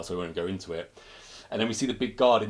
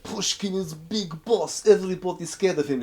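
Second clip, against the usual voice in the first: mock Russian accent; 9 semitones higher, 4 dB louder than usual. Krichkov explaining to the Wishmaster, mock Russian accent. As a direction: drag it out like a nervous Russian gangster.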